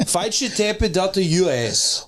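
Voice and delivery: put-on Brazilian accent with added vowel endings. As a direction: pitch it like a Brazilian.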